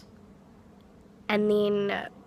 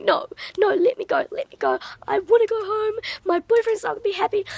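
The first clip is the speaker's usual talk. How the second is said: high-pitched, frantic acted plea; retold pleading; 13 semitones higher, 4 dB louder, faster than usual. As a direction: replay begging to get out, pitched way up. Retells pleading to be let go.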